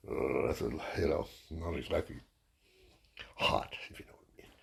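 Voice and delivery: whispered innuendo; whispered aside. Real, not acted. Comedian sneaking a joke to the crowd, whispered. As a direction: drop to a whisper for the punchline. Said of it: "aside about birthday girl".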